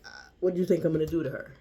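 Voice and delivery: mock the crowd's scoffing; mocking passengers. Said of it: "the entire train mocked me".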